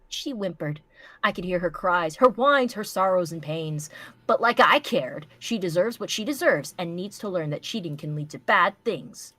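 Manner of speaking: ordinary talk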